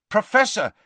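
high, loud, urgent call. A narrator's reading. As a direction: call out loudly to rouse him. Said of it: "he repeated loudly".

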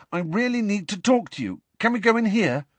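high, pressing request; urgent plea. A narrator's reading. Harry needs a private word with Nick, urgent.

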